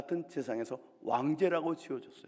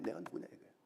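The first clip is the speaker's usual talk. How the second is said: whispered repeat; 8 dB quieter, faster than usual. Shown as a demonstration.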